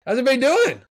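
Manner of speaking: high, loud and beaming, pumped-up hello; upbeat coach greeting. Retold or imitated. Coach walking into the locker room before a big game, high and loud with a big smile. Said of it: "a coach comes in before a big game and just kinda has a smile on their face".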